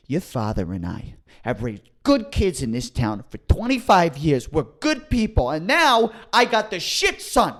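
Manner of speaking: mock a mother's outrage building to a yell; furious mom. Retelling his mom scolding him, building to a high yell.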